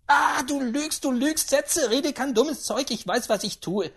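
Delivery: high, heated protest; worked-up old lady; trembling with agitation. A narrator's reading. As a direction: shout him down in agitation. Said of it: "Sie zitterte vor Erregung".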